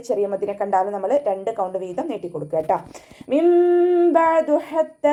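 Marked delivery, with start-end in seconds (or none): 3.3-5.1 high, slow chanted verse; recitation demo. Shown as a demonstration.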